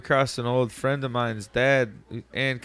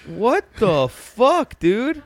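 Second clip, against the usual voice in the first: slow, high, drawn-out what the fuck through a laugh; laughing in disbelief at the old man; stunned disbelief; 8 semitones higher, much slower than usual. Retold or imitated. A friend retelling a scooter man's scolding, incredulous and laughing. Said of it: "I was like".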